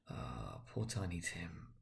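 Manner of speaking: hushed, slow sigh of grief for Tiny Tim; soft grief. Acted. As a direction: let it fall to almost nothing, mourning.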